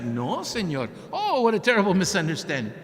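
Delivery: high, loud, panicked apology; grovel, suddenly all politeness; flustered backpedal. Retold or imitated. Caught doctor backpedals to the reporter, high and flustered. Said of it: "the guy was like".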